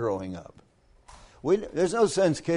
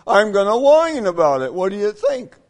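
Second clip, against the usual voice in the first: louder, higher, whiny mock complaint; 6 semitones higher, 6 dB louder than usual. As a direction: play the complainer sulking over his problem. Mock congregant whining about problem.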